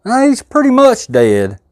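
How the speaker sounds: high, slow mock drawl. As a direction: parody his words, sing-song. Mocking the other host's phrasing.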